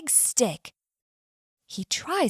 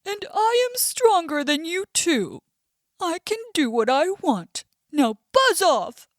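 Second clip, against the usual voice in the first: higher, louder bullying bear; annoyed bear; 5 semitones higher, 4 dB louder than usual. Acted. Bear bossing the bee away, annoyed and boastful. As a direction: throw your weight around. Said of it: "Mister Bear is annoyed".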